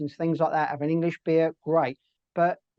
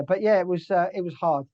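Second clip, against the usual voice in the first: normal talking voice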